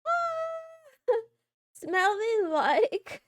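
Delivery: high squeal breaking into laughter; laughing squeal. Real, not acted. Laughing at chat's flowers comment, high squeal. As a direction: squeal then laugh through the words. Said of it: "Smelly like flowers?".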